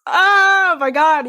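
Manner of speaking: slow, loud giddy gasp; excited gasp. Retold or imitated. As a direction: gush like a message just came. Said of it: "I'm just".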